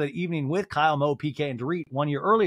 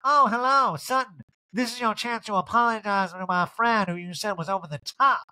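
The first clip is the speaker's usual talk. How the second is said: higher, put-on confrontational voice; emphatic mock call-out, pitched up; Erica; 7 semitones higher than usual. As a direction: challenge in an exaggerated raised pitch. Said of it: "Erica goes".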